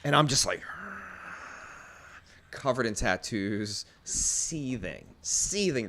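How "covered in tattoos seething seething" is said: low, soft, simmering delivery; quiet seething. Retold or imitated. Telling how he stood facing the wife, seething quietly. Retelling his silent seething.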